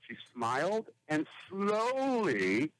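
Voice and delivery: ordinary talk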